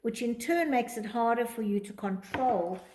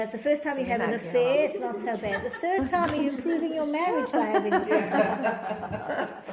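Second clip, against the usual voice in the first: rising, louder with a laugh breaking in; 4 semitones higher, 4 dB louder than usual. Real, not acted. To a listener about justifying an affair, laughing. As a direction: build the joke up into a laugh.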